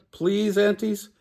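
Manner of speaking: high pleading call to the aunties; begging. Acted. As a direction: plead with big eyes.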